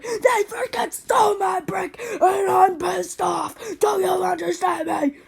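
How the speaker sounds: high, loud, shouting through tears; yelling to mom that the brick was stolen, furious; angry tearful shout. Retold or imitated. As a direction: vent the fury at mom.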